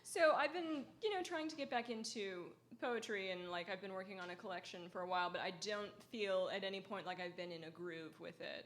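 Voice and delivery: normal talking voice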